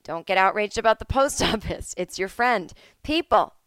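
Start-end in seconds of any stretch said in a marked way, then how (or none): 3.0-3.5 calling out. Real, not acted. Calling out to audience.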